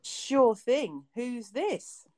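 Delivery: normal talking voice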